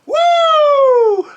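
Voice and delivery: high, loud whooping cheer; hype whoop. Real, not acted. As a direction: let out a big whoop. Pumped after the salts.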